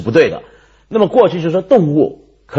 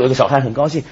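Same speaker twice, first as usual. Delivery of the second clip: ordinary talk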